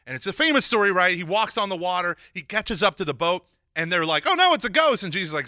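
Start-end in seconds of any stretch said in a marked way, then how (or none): 4.3-5.0 higher, louder mock panic; frightened cry. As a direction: retell the disciples' alarm, shrieking a little. Disciples see figure on water.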